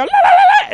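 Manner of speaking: very high babbling cackle; mock uncontrollable laughing yelps; imitated helpless laughter. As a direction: act out a hysterical high squeal. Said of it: "all of a sudden I go".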